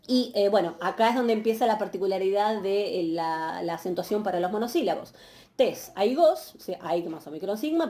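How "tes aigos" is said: aigos stressed on its last syllable, slowly; slow tes aigos, landing on GOS; acento final. Shown as a demonstration. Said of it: "con el acento hacia final de la palabra". (tes aigos despacio, con acento en GOS)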